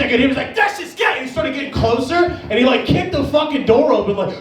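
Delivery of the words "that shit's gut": high-pitched imitation of an irate heckler; mock outraged shout. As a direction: act out an indignant yell, pitched way up. Same outraged man, quoted.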